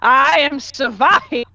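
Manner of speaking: slow, pompous proclamation, pitch lifted; booming announcement. Acted. Warrior naming herself a second time, booming and pompous. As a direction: boom it out grandly. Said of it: "an even more booming".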